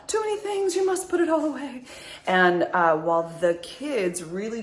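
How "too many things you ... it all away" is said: high, fretful inner voice; gasp, then a high mock panic; overwhelmed brain, pitched way up; panicking brain. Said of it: "the brain's like".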